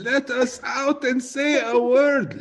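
high, drawn-out mock wail; Lebanese heritage breaking out. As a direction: let it burst out high and slow. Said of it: "Lebanese heritage just going".

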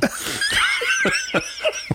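squeaky high laugh; high giggle. Real, not acted. Laughing at own bit.

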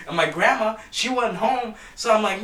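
ordinary talk

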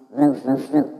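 mock dog barks. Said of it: "mocking the dogs".

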